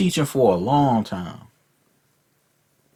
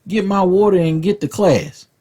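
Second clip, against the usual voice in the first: higher, heated mock comeback; mock outraged retort; 7 semitones higher than usual. Comic voicing his teenage self's comeback to the teacher, higher and heated. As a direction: fire back at the teacher, outraged. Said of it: "I'm like".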